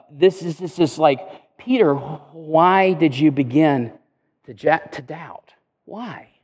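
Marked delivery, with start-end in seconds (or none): 1.6-6.3 slow, hushing, sincere inquiry; honest question. Acted. Preacher voices Jesus asking Peter without blame, honest question. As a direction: ask gently, trailing down to a near-silent why. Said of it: "but perhaps an honest question".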